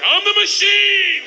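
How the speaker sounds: high, loud, drawn-out roar; triumphant yell. Acted. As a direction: bellow it like a battle cry, stretching the last word. Proudly repeats it to laughing gangster.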